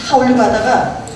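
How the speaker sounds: ordinary talk, nothing marked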